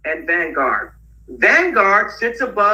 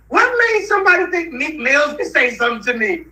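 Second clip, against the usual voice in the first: high-pitched disbelief, tipping into laughter; incredulous outburst; 10 semitones higher than usual. Real, not acted. Speaker baffled that [name] was sent to talk to him, incredulous. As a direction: voice leaps up in amused disbelief.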